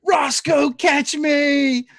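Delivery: high, urgent called-out yell. Acted. Player's character yells to Roscoe mid-dive, yelling. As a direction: call out high to a comrade. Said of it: "and I'll yell".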